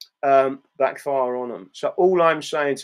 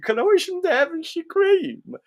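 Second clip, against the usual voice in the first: a hammed-up West Country accent, pitched much higher; mock Devon accent; 13 semitones higher than usual. Trying out a Devon accent for a viewer from Devon, hammed-up. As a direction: roll the R's in a jokey Devon accent. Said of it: "Listen to the accent".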